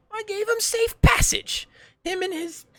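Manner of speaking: very high, loud villain shout; hammed-up shouted retort; villain yelling. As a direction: yell it back defiantly in a strained high voice.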